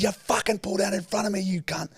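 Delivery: high-pitched angry mock yell; strained high shout at restrained volume; retold screaming. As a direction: re-enact yelling back from the car. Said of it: "me and him screaming at each other".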